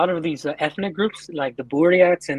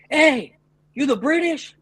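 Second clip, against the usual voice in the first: retold yell; 9 semitones higher, slower than usual. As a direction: imitate hailing someone from afar. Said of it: "I screamed out like".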